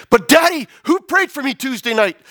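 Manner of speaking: high, insistent daughter's question; acted urgent demand. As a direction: press father for an answer.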